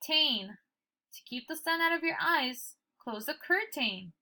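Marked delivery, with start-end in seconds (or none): 3.3-4.1 curtain as "cur-TAYN", full long A; wrong reading of curtain. Shown as a demonstration. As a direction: rhyme the end of curtain with "rain". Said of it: "we don't say curtain".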